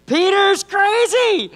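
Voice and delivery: high, slow, loud call; preacher calling back Peter's crazy loudly; called-out echo. Shown as a demonstration. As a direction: belt it to the congregation. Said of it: "somebody say".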